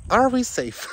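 high, loud worried blurt; anxious high question. Real, not acted. Hiker alone on a wolf trail, anxious loud question. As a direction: ask it like the wolves are close.

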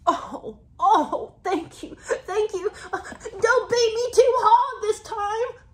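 high, slow pleading; hammed-up grateful simp; mock grovelling. Mocking Harry's grovelling.

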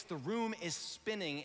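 ordinary talk

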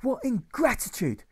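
high, slow, loud yelp; ungraceful yelp. Acted. Teacher yelping at the children, ungraceful yelp. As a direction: yelp it out, squeaking with outrage. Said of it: "he yelps ungracefully".

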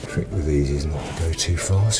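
ordinary talk, nothing marked

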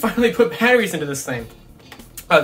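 usual speaking voice

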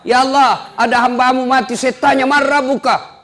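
high, earnest angel report. Acted. Angel reports to Allah, high and eager. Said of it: "malaikat naik melapor kepada Allah".

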